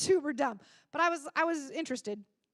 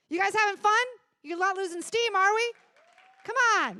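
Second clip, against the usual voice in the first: higher and louder, pumping up the room; hyping the crowd; 7 semitones higher, 6 dB louder than usual. Real, not acted. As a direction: get the audience going. Host hyping the audience.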